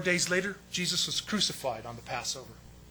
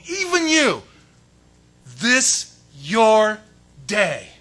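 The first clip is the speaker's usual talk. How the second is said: anguished cry; 5 semitones higher, 13 dB louder, much slower than usual. Acted. Jesus grieving the missed day.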